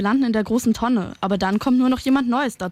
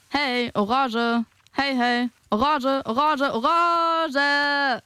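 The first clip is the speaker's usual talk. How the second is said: mocking drawn-out call; 3 semitones higher, much slower than usual. Peel mocks Orange back.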